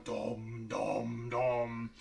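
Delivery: slow, drawn-out low "dom" sounds; low, ponderous humming booms; imitated ominous booming. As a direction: boom each one like a huge looming object. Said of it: "You're just kinda going".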